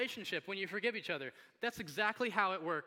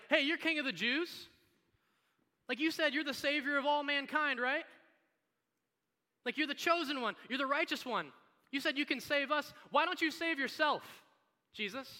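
higher, louder jeering challenge; mocking crowd; 8 semitones higher, 4 dB louder than usual. Crowd taunting Jesus on the cross, raised mocking challenge. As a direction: taunt him as a heckling onlooker. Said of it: "people down on the ground looking up at them".

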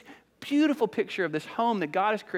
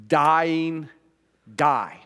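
DYING and DIE each landed hard and loud; emphatic statement; 5 dB louder than usual. Shown as a demonstration. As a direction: set DYING and DIE apart as heavy single blows. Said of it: "it's an incredibly emphatic statement".

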